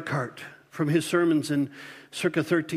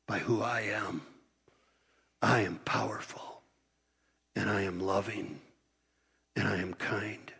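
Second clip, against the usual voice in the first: tender and hushed; 8 semitones lower, 6 dB quieter than usual. Shown as a demonstration. God gently preparing Job to see him, tender and hushed. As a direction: lean in and reassure him quietly. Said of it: "how is he saying this?".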